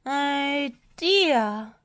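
high, loud, drawn-out dismay; dismayed exclamation. A narrator's reading. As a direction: exclaim in storybook alarm. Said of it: "exclaimed Missus Potts".

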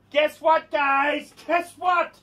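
high, excited announcement; hyped-up high-pitched hook; excited teaser. Real, not acted. Hyped channel intro, teasing news.

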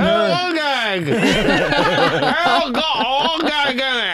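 talking with jaw locked open; jaw stuck open. Acted. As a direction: mouth stuck open, words mangled. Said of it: "It's hard to say it 'cause my jaw is stuck".

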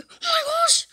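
high, squealed alarm; play-acted alarm. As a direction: squeal it in mock panic. Alarmed at seeing a zombie.

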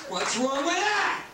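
high, drawn-out mocking whine. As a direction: whine the catchphrase up high and slow. Repeated mocking catchphrase.

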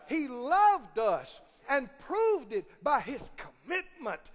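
preaching at full force. Acted. Preacher praising God's love to the congregation, full force. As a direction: lift the church with it.

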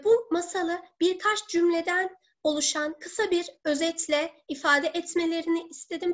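normal talking voice

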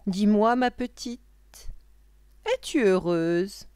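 slow witch's questioning. Acted. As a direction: coax the child as a witch, drawn out. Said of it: "lui redemanda la sorcière".

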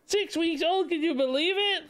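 high delighted cooing. Real, not acted. Talking to the baby.

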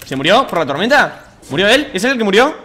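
high, loud incredulous questions; loud disbelief. Real, not acted. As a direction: call out in astonished disbelief. Astonished at opponent's death. (incredulidad a gritos)

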